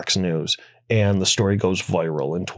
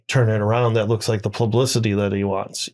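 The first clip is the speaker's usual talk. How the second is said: ordinary talk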